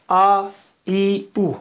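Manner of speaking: loud clear آ then ای and او; vowel demo. Shown as a demonstration. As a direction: show the three vowel sounds آ، ای، او distinctly. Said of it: "یہ آپ نکال سکتے ہیں کہ نہیں". (آ، ای، او کی واضح مثال)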